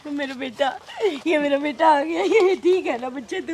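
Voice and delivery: soft, crying mother; tearful relief. Acted. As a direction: cry softly over your returned son. Mother reunited with son, weeping. (धीमे, रोते हुए राहत से)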